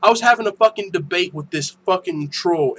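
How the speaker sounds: ordinary talk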